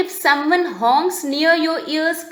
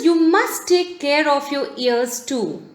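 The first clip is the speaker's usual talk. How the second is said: ordinary talk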